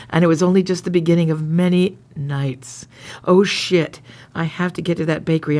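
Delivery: low, earnest romance reading; story narration. Acted.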